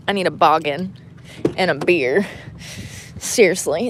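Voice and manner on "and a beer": ordinary talk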